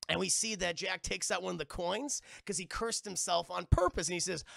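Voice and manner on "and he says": plain reading voice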